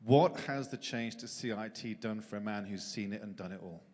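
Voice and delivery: usual speaking voice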